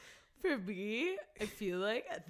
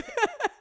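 high burst of laughter; 10 dB louder than usual. Real, not acted.

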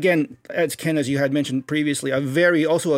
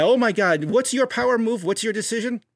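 excited friend; 6 semitones higher than usual. Retold or imitated. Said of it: "your pal does that".

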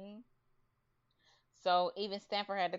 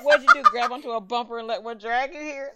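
much louder, a scolding boss; mock yell; 3 semitones higher, 12 dB louder than usual. Retelling the boss scolding Carrie, mock loud yell. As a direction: bark at her for showing up late.

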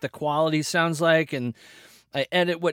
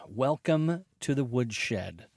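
drawn-out, softer, lower show intro; slow low announcer; 4 semitones lower, 6 dB quieter, slower than usual. Shown as a demonstration. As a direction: savour the show name slowly. Repeating podcast greeting.

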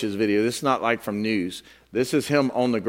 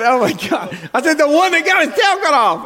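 higher, louder, amused exasperation; mock exasperation; 4 dB louder than usual. Pastor retelling his reply to a clueless friend, mock exasperated. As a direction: throw up your hands in mock disbelief. Exasperated retort in retold joke.

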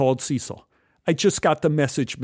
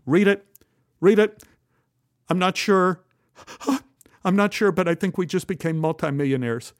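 high, urgent, gasping; agitated excitement; 6 semitones higher than usual. A narrator's reading. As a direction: blurt it out, stunned. Shocked man urges wife to read.

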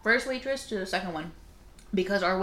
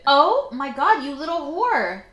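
higher, louder playful scolding; pretend-scold the naughty pet; mock outrage; 8 semitones higher, 7 dB louder, slower than usual. Real, not acted.

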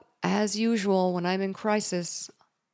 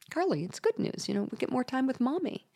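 put-on higher, softer, quick reassuring to a child; forced upbeat reassurance; 6 semitones higher, 6 dB quieter, faster than usual. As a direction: soothe a sad little girl with fake brightness. Mother reassuring sad daughter.

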